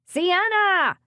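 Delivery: loud, high call from another room; shouting a name. Acted. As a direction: yell her name to summon her. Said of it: "as Salvia shouted her name".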